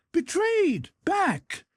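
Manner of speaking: high alarmed shout. Acted. Dolokhov yells a warning of betrayal, high alarmed shout. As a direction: warn him there's a trap, full voice. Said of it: "shouted Dolokhov".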